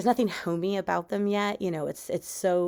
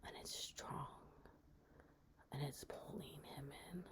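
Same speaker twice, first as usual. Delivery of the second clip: hushed horror narration; 4 semitones lower, 13 dB quieter than usual. A narrator's reading. Storyteller describing the dead clawed hand, hushed horror. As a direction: sink into a creepy near-whisper.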